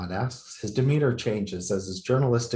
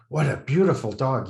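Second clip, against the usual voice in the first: put-on higher, louder, cooing at a small child; playful baby talk; 4 semitones higher, 4 dB louder than usual. A narrator's reading. Journalist greeting the dog, playful and higher. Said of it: "he says in a playful voice, like he's talking to a small child".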